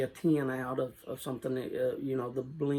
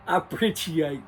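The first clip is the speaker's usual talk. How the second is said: laughing through the words; cracking up, can't finish; 5 dB louder than usual. Real, not acted. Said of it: "I can't even speak now".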